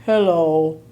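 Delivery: low, human-sounding greeting; dog saying hello. Acted. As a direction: drop the voice for a deadpan hello. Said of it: "George went".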